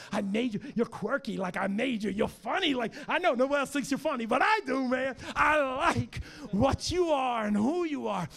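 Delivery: high, loud crying shout; impassioned shout. Acted. God passionately shouting his love to the listener. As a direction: pour it out, almost in tears.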